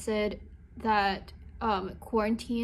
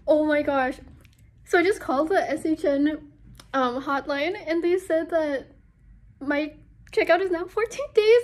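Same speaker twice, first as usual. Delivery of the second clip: elated; 5 semitones higher, 7 dB louder than usual. Real, not acted. Thrilled at early release.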